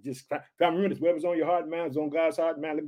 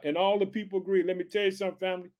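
ordinary talk, nothing marked

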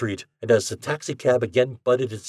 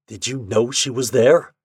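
usual speaking voice

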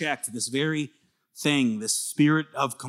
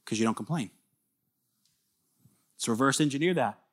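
ordinary talk, nothing marked

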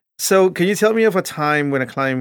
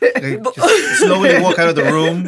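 talking through laughter, slowed and louder; laughing; 4 semitones higher, 7 dB louder, slower than usual. Real, not acted. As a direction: giggle the words out. Joking about awkwardness with crying.

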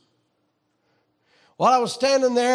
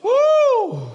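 a loud high joyful whoop; whooping with joy in the pulpit; ecstatic whoop; 6 dB louder than usual. Real, not acted. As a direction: let out a happy church holler. Ecstatic whoop mid-sermon.

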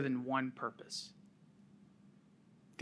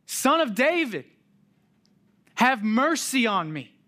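even louder and higher plea; crying out louder; 8 semitones higher, 8 dB louder than usual. Retold or imitated. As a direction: shout it, desperate to be heard. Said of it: "He cries out even louder".

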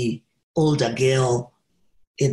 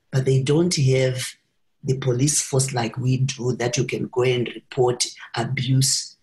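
normal talking voice

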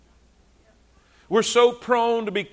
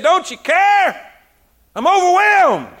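higher, louder, slow whiny complaint; frustrated Martha; 8 semitones higher, 5 dB louder than usual. Retold or imitated. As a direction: whine like an overwhelmed sister. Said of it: "she comes to Jesus and said".

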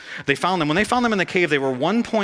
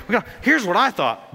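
ordinary talk

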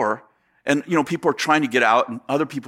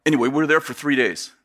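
ordinary talk

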